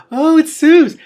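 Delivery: high, loud squeaky lizard voice. Acted. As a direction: squeak it out like a tiny lizard wizard. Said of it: "said a squeaky voice".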